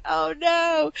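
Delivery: a high, sudden mock "oh no"; a pang of dismay, played up for the story. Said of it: "I was like".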